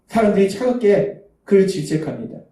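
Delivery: ordinary delivery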